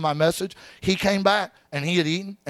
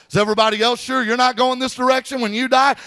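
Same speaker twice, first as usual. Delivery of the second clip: mock announcement; 5 semitones higher, 4 dB louder than usual. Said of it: "will announce to the whole elevator".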